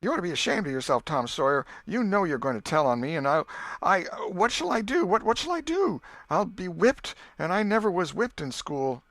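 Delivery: put-on high, loud, panicky girl's voice near tears; young girl begging in rising panic; distressed schoolgirl. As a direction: plead like a frightened girl about to be whipped. Becky upset, fearing a whipping.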